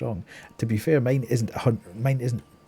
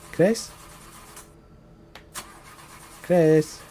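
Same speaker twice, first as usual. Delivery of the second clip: calling out; 6 semitones higher, 5 dB louder than usual. Real, not acted. Calling out for missing Chris.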